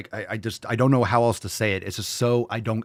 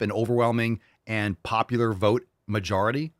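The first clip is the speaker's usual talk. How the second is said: usual speaking voice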